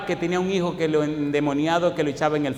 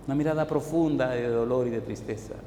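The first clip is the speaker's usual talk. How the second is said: usual speaking voice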